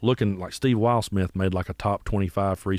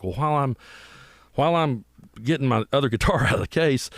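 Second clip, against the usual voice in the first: ordinary talk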